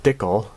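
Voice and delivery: dickle said DIK-ul; decal as dickle, short i; Canadian dickle. Shown as a demonstration. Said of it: "as the Canadians usually say".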